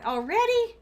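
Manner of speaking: high, loud cry of disbelief; exasperated disbelief. Real, not acted. Streamer reacts to an interruption, high exasperated cry. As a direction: groan out already in dismay. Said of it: "Oh, God".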